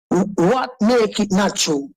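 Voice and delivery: ordinary talk, nothing marked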